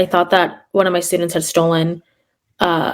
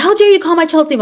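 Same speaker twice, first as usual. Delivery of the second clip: mock outraged parent; 8 semitones higher, 4 dB louder, faster than usual.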